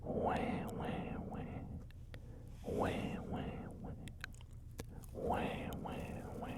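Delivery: put-on whispered baby wails; mock baby crying. Joking that he's a crybaby to viewers, mock whispered wah. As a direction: fake a baby's cry in a whisper. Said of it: "Baby crying A S M R".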